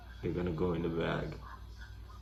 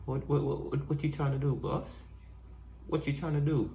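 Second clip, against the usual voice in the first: higher playful animal voice; voicing an animal; 7 semitones higher than usual. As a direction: dub the animal, teasing.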